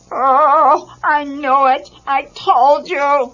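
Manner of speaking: a fretful bear crying out in alarm; high worried bear wail; Fozzie crying out. A narrator's reading. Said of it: "cried Fozzie".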